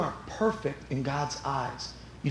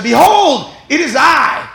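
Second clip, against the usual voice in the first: booming proclamation, much higher and louder; grand shout; 12 semitones higher, 15 dB louder, slower than usual. Acted. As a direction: announce yourself like a king. Grand proclamation of whale-man.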